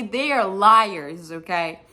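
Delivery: usual speaking voice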